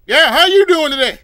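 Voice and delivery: loud, high fake-cheery greeting; chirpy cashier. Cashier forcing cheer at a customer, loud and high. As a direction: greet with a big fake smile.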